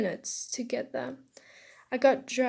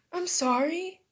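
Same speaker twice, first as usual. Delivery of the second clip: higher, rising apology; apologetic; 6 semitones higher than usual. Acted. Boy to crying classmate, apologetic. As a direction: apologize in a small raised voice.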